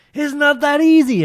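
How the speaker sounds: very high, loud, slow mock whine; whiny girl voice. Mocking the meme girlfriend who can't choose, high whine. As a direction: drag it out in a squeaky mock girlfriend voice. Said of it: "the girl's like".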